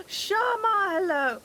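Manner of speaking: high, loud greeting that cracks. Real, not acted. Streamer greeting Sharma as they arrive, voice cracking. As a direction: call out hello with the voice cracking. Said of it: "Oh, voice crack".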